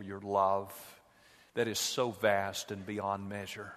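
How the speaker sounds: hushed, reverent prayer voice. Acted. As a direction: pray gently to the Lord. Pastor leads a prayer.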